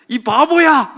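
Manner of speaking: high, loud playground taunt; mock insult shout. Preacher showing the comeback trick, mock shouted insult. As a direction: call someone a fool in mock anger.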